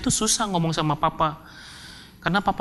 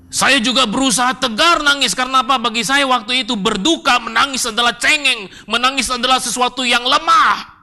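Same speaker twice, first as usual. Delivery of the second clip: high, loud, insistent preaching; impassioned, raised-voice insistence; vehement declaration; 7 semitones higher, 4 dB louder than usual. Real, not acted. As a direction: hammer it out, building to a shout. Impassioned account of forced toughness.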